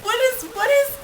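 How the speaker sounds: high, disbelieving "What?"; incredulous. Real, not acted. Host outraged by the father leaving his daughter, incredulous. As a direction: sputter in disbelief.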